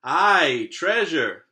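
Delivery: loud rowdy crew shout; pirate crew shouting. Acted. Crew shouting about treasure, loud. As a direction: roar it like a greedy crew. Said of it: "the others shouted".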